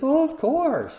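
high, eager "of course"; mock confident. Our inner voice answering God, high and eager. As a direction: toss it off like it's obvious.

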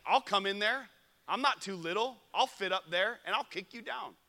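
high, loud playful comeback; hammed-up indignant retort; mock threat. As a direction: square up to a little kid in jest. Mock retort to a six-year-old.

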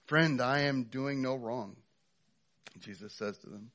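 loud, high impassioned sermon; full-force preaching. Acted. Pastor preaching to his church at full force. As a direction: drive it home to the congregation.

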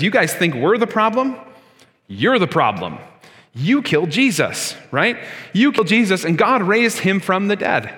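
pointed, challenging retort, rising then dropping; bold accusation. Retold or imitated. Preacher voicing Peter accusing the Sanhedrin, pointed retort. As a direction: throw the charge back at them. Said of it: "he essentially says".

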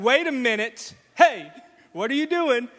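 dad reacting to daughters' impression; mock alarm. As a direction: cry out in mock dismay. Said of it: "I'm like".

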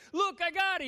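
high, loud triumphant shout; excited calling out. Acted. Andrew shows Jesus the boy he found, excited shout. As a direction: call it out proudly over a crowd.

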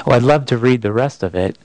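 ordinary delivery

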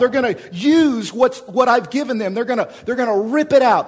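loud, high, driving sermon voice; fired-up pulpit delivery; full-force preaching. Acted. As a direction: preach it out to the back row.

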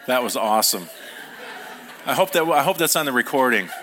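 talking through real laughter; amused, still laughing. Real, not acted. Laughing at interruption.

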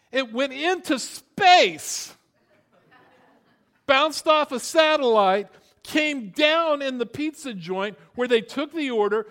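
loud, high, emphatic preacher build; full-force preaching. Real, not acted. Preacher marvelling to congregation at pizza ordering, loud and emphatic.

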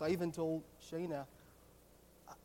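ordinary talk

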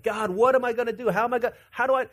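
mock panic from Abraham; flipping out. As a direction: gush it out like a man losing his nerve. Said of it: "flipping out".

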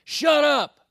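put-on a kid's sharp yell, high and loud; mimicking a daughter snapping at her sibling; imitated yell. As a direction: bark it like a bossy big sister. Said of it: "turns around to my youngest and says".

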